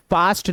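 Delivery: फास्ट with a long open "aa"; wrong vowel. Shown as a demonstration. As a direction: say फास्ट as "faast". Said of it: "नहीं होगा".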